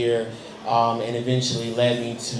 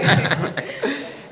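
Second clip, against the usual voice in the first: short real laugh, pitched up; bright high laugh; 12 semitones higher than usual. Real, not acted. Guest laughing about a night out with Eddie. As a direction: laugh at your own joke.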